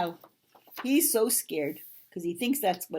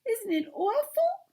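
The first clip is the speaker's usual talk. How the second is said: very high, appalled squeak; tiny character in dismay; frightened Piglet; 5 dB louder than usual. Acted. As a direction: ask in horrified high little voice. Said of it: "said Piglet".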